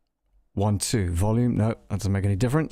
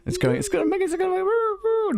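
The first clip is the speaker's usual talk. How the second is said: pitch-shifted effect voice. Real, not acted. Voice processed by effect.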